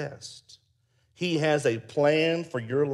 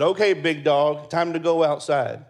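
loud, higher call to the big dog; 4 semitones higher, 9 dB louder than usual. Retold or imitated. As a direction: call across the room to rouse the dog. Calling the dog to go out.

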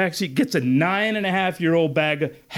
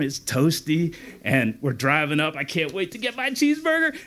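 ordinary talk

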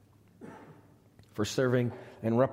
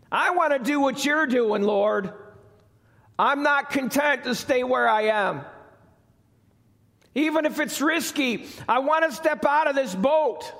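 high, loud, eager pleading; eager plea; 12 semitones higher, 6 dB louder than usual. Retold or imitated. Peter calling to Jesus from the boat, eager plea. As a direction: beg with burning eagerness. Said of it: "And he's like".

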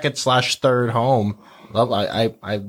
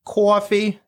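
higher-pitched Long Island vowel on coffee; Long Island accent; 8 semitones higher than usual. Retold or imitated. Quoting her slight accent on certain words, Long Island accent. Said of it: "Long Island accent".

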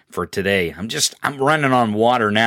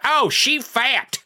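high, slow sneering mock voice; drawn-out taunting impression of a bigot; mocking critics; 10 semitones higher than usual. Host mocking [name]'s right-wing critics in a high, slow sneer. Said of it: "But they can be like".

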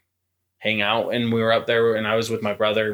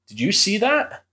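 startled question; 11 semitones higher than usual. Retold or imitated. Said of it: "And he's like".

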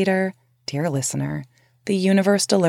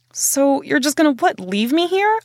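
hounding; 7 semitones higher than usual. Retold or imitated. Narrator pressing Paul who won't let her stay, incredulous. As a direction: push him, stretch out leave me here. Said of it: "I hounded him".

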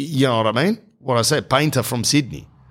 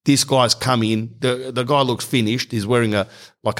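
usual speaking voice

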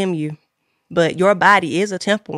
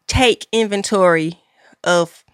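ordinary talk, nothing marked

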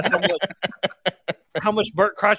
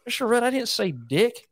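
put-on higher buck-toothed little boy voice; Dalton, country kid; 7 semitones higher than usual. Pupil denying it to his teacher, high kid voice. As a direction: imitate a puzzled second grader protesting. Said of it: "he looked at me like".

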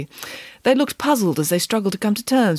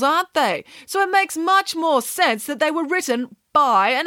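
high, emphatic, persuading voice; assertive nanny; 6 semitones higher than usual. Acted. Nanny persuading children animals wrote the fables, assertive.

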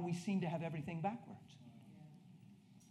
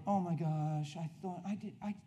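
mock self-pity; 4 semitones higher, slower than usual. Passenger stuck in middle seat, mock whining. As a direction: draw out a hammed-up complaint to God.

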